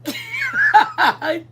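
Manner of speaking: a high, shouted burst of laughter; shrieking laugh. Real, not acted. Laughing at Joe's onion breath.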